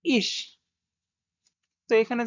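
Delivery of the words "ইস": ইস spoken as German ich; German ich sound. Shown as a demonstration. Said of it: "এভাবে উচ্চারণ হবে".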